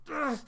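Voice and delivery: high pained groan; acted high grunt of pain. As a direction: groan like you've just been hit. Ison gets shot.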